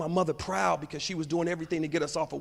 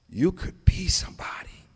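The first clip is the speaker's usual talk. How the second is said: awed whisper to himself. Real, not acted. Boy repeating the teacher's praise to himself, whispered. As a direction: breathe the words in wonder.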